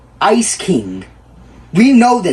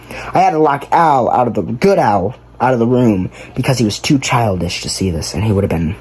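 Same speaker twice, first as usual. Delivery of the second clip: usual speaking voice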